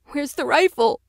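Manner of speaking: high, loud frightened question; desperate cry for the rifle; terrified boy asking for the rifle; panicked cry. Acted. Rifle missing, biters at door.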